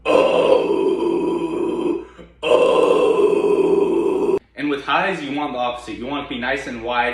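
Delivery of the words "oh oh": deep raspy low scream, round mouth; low growl. Shown as a demonstration. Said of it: "toilet bowl shape with your mouth".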